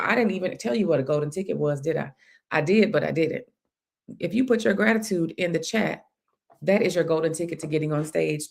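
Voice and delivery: ordinary delivery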